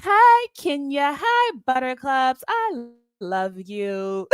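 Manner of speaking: high, slow, sugary hello in a put-on voice; sweet high welcoming voice. Imitating the host greeting her chat sweetly. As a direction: coo each name sweetly and slowly. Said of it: "she'll go back".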